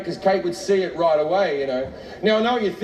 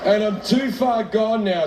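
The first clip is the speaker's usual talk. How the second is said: ordinary talk, nothing marked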